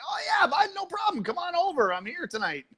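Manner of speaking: high, playful put-on voice; wrong-number voice. Host showing how he answers wrong calls, high and playful. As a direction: pretend to be the friend they expected. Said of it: "That's how I answer wrong calls".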